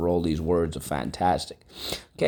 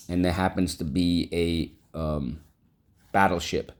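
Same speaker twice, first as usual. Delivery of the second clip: usual speaking voice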